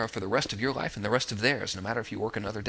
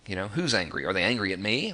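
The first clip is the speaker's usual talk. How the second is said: ordinary talk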